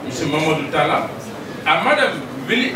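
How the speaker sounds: ordinary talk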